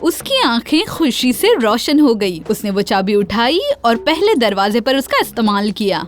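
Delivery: usual speaking voice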